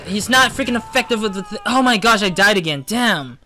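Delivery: loud, high exasperated outburst; frustrated yelling. Real, not acted. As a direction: yell at the game after dying. Dies repeatedly, yelling at game.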